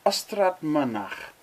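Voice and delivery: "Ystrad Mynach" slowly with y as a vowel and guttural ch; slow Welsh demo. Shown as a demonstration. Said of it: "accepting that Y can be a vowel".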